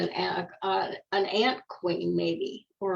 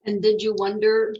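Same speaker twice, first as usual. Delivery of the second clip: gentle storybook reading voice; reading to children. Acted. Reading a flower picture book to a listener, gentle storybook voice. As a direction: read it like a bedtime picture book.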